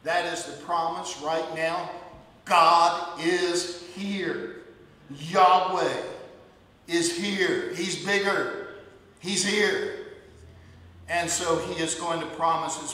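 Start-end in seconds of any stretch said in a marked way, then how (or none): 2.5-10.0 very slow, louder declaration; slow proclamation. Acted. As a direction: let each word ring out.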